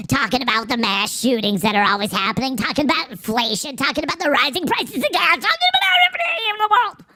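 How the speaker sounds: starts normal, climbs to a very high fast squeak; building into cartoon squeak. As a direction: let the rant run up into the cartoon voice.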